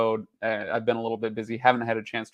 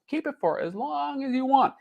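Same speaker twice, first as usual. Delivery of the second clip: lightly voiced salesman, much higher; high sweet pitch voice; 13 semitones higher than usual. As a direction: sing it out like a sweet offer. Repeats Netflix pitch line.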